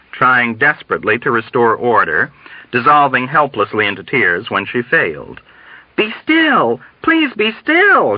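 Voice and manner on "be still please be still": high, desperate plea; tearful urging. A narrator's reading. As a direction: beg helplessly, voice pitched way up. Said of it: "urged uselessly through her massive sobs".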